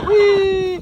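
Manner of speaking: loud, very high whee; playful squeal. Real, not acted. Owner playing with Bambi, loud high squeal.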